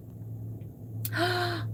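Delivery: gasp. Real, not acted. Reading exciting chat news.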